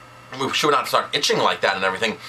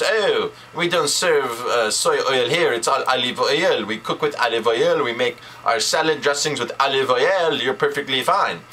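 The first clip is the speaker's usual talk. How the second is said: put-on French accent. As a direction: do a breezy French-accented waiter. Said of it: "the waiter in his French accent".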